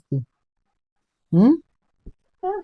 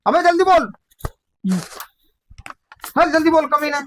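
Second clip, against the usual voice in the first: raised, higher-pitched impatient order; angry demand; 7 semitones higher, faster than usual. Real, not acted. Exorcist cursing the spirit for stalling, angry and impatient. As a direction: press hard for a quick answer.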